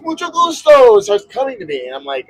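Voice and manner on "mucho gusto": high-pitched imitation of a hearty hello; effusive greeting. Stranger walks over to introduce himself, effusive greeting. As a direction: mimic an eager Spanish introduction.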